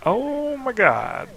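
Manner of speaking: high, drawn-out, slow oh my God; disgusted oh my God. Retold or imitated. Seeing the caked dog mess, slow high disgust. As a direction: stretch it out in high disbelief.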